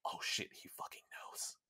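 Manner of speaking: panicked stage whisper; conspiratorial whisper. Retold or imitated. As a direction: hiss it like a secret just caught out. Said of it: "the facade dweller goes".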